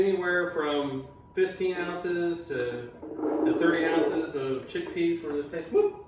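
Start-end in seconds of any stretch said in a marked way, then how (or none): 5.7-6.0 surprised yelp. Real, not acted. Chickpea falls out.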